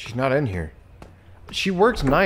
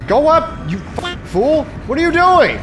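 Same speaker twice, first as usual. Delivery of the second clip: high, loud yelling at the screen; frustrated yelling; 10 semitones higher, 5 dB louder than usual. Real, not acted. Gamer yelling at his character, frustrated shout. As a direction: scream at the fool who won't move.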